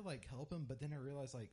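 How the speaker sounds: ordinary talk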